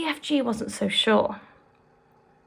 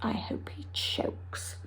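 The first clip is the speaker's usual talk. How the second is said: slow, much softer whisper; whispered spite; 11 dB quieter, slower than usual. Acted. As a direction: hiss the wish under breath. Sophie's muttered wish.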